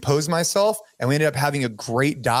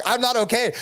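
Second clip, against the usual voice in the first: pitched way up, half-shouted I'm not okay; mock scream; 9 semitones higher than usual. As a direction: pretend to scream it from the surface. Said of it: "screaming like".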